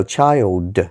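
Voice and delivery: put-on CHILD with a strongly sounded D at the end; slow "child", finished on a distinct D; hard final D. Shown as a demonstration. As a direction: draw out "child" and land the final D crisply. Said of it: "Really make the D".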